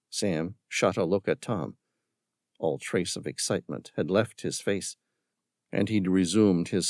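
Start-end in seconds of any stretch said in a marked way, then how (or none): none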